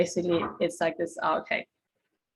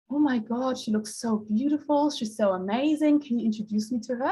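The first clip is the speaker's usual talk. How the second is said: gushing admiration; 3 semitones higher than usual. Retold or imitated. Woman asking to meet someone she admires, gushing. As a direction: gush in awe, pitched up.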